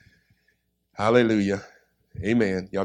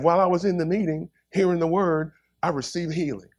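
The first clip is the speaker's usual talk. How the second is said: raised, eager retold testimony; excited testimony; 7 semitones higher than usual. Healed woman explains her miracle, excited. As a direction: retell her testimony in a higher voice.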